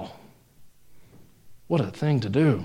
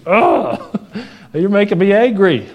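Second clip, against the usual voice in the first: higher, louder mock exasperation with a laugh; mock exasperated God; 7 semitones higher, 4 dB louder than usual. Preacher voicing God as fed up with Moses, mock exasperated. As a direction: play God fed up with excuses, half laughing. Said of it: "finally God just says".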